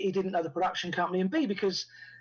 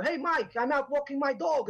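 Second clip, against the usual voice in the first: high, leisurely imitation Dutch accent; cheery imitated Dutch caller, pitched up; Rutger Hauer; 7 semitones higher than usual. Actor phoning director from a dog walk, Dutch accent. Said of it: "he'd just phone me up and go".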